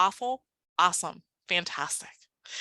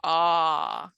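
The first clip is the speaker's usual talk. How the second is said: AH held long and steady; one long, sustained AH; stretched out; 2 semitones lower than usual. Shown as a demonstration. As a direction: draw out the sound AH for a class to copy. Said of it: "Let's stretch it out".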